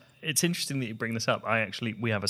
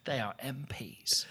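whispering; 7 dB quieter than usual. Acted. Hushed scandalised reveal.

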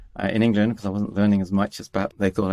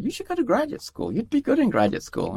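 high, far voice for the scientist; a high mock voice for the scientist urging grad school; quoting the scientist. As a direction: lift the pitch way up to play the scientist. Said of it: "one of the scientists said".

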